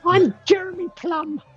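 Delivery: very high, bright little pixie; squeaky fairy-folk greeting. Acted. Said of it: "I'm gonna have to try and do a pixie voice here".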